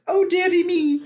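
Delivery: affectionate imitation in a Scottish accent. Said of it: "I love the way she goes".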